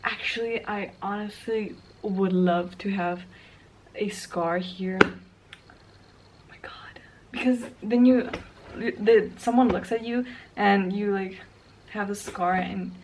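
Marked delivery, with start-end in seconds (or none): 5.0-7.0 awed whisper; hushed astonishment; whispered oh my god. Real, not acted. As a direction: breathe it out as a whisper. Reacting to a scar.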